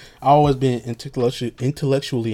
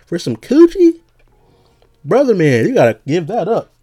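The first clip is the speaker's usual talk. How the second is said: rising higher and louder to a squeal on coochie; ranting to friends about the movie's hero, climbing higher; incredulous rant; 6 semitones higher, 4 dB louder than usual. Retold or imitated. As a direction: build from disbelief to a high outraged squeak. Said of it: "And I'm like".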